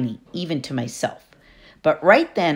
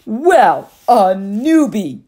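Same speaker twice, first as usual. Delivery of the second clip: put-on loud, husky girl's yell, drawn out slowly; 6 semitones higher, 7 dB louder, much slower than usual. Camp bully hails the new kid, husky loud yell. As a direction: bellow it out like a swaggering camp bully. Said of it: "a husky voice yelled".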